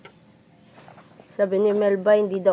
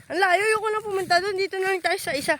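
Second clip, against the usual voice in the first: 1 fast whiny grumble; 10 semitones higher, much faster than usual. Pretend. Voicing reluctant walker.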